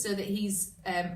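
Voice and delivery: usual speaking voice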